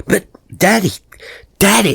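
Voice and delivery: put-on boy pleading urgently with his father. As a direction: beg to be heard, like a small child.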